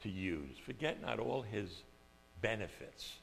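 thinking aloud; musing to himself. Retold or imitated. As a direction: puzzle over the word quietly. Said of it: "And I says".

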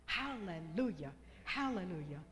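usual speaking voice

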